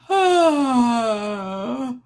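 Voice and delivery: long, open, deliberate yawn; big yawn. Real, not acted. Leading the chat in a yawn, big deliberate yawn. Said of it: "Okay, we're gonna yawn again".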